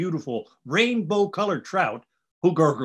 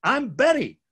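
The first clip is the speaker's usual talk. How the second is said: high, bright bee voice pitched way up; a welcoming bee greeting the travelers, much higher; friendly buzzing bee; 10 semitones higher than usual. Acted. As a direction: sing it out like a cheerful little bee. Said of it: "the friendly bee buzzes".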